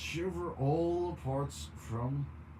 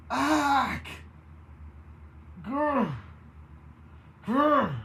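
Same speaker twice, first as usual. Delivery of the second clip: high, loud pained growls; shrieking in agony; 11 semitones higher, 4 dB louder, much slower than usual. A narrator's reading. Baroness shrieks and growls in agony as she changes. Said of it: "shrieks in agony".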